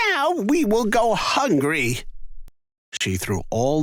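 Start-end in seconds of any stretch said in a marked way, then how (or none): 0.1-2.0 high lamenting mother's voice; a mother despairing over supper; upset mother. A narrator's reading. Mother lamenting to Jack, high and upset. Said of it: "cried his mother angrily".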